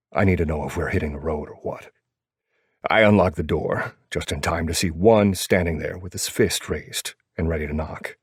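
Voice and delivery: ordinary delivery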